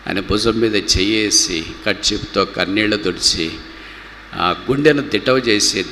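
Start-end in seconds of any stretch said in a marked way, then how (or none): none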